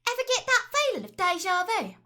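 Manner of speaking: high, loud perky British game-heroine voice; Tracer impression. Showing a friend her Tracer tick, high perky voice. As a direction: chirp it like a plucky video game heroine. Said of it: "the tracer tick".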